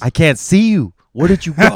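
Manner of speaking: put-on high, loud singsong calling for a hiding child; dad playing hide and seek with his daughter, high and loud; playful peekaboo voice. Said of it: "She was just going around the house".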